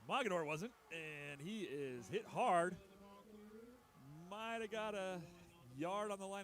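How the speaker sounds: loud punchy play call; slow, loud and hard on the hit; excited. Real, not acted.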